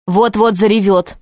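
usual speaking voice

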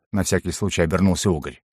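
usual speaking voice

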